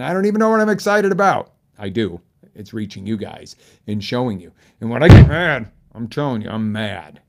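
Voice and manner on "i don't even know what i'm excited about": much higher, loud, fast burst of excitement; real excitement. Real, not acted. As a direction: let the thrill bubble over. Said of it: "I am really excited when I say that".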